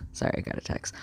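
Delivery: hushed, breathy aside; whispered aside. Real, not acted. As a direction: whisper it quickly under your breath. Aside while a text arrives.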